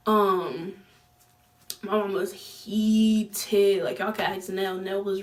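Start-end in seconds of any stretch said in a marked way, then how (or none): none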